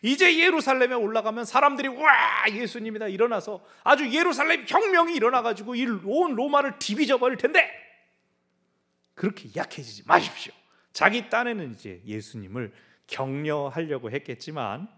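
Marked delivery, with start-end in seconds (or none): 0.0-10.5 high, loud eager rallying; Peter's zealous pep talk; Peter excited. Retold or imitated. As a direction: fire up the master with big dreams. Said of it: "베드로가".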